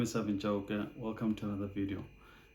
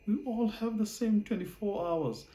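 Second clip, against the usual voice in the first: much higher mock voice of people repeating a cliché; mocking quote; 12 semitones higher, 3 dB louder than usual. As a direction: parrot it in a high put-on voice, derisively. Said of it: "garbage that people talk about to say".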